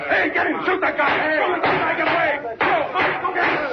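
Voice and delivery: high, urgent yells after the runaway; frantic shouting. Acted. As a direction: scream orders at the men to stop him. Suspect escapes, cop calls out.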